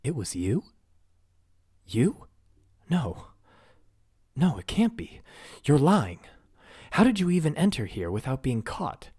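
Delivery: high, loud, rising panic; demanding answers in alarm; frantic disbelief. Acted. Princess panicking about her missing family.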